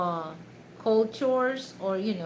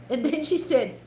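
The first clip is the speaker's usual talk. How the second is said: ordinary delivery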